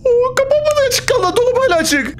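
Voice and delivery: sudden high alarmed cry; high startled yelp. Real, not acted. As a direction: jump in fright at the open closet. Startled as closet stays open.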